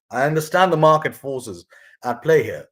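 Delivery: ordinary talk, nothing marked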